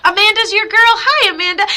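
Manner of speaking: high, loud bright hello; light up and greet her; excited greeting. Real, not acted. Medium happily greeting a viewer by name.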